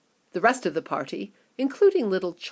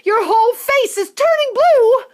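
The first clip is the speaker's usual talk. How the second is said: very high, loud panicked mother; horrified rising shrieks; screaming mother; 9 dB louder than usual. Acted. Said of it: "screamed Missus Beauregard".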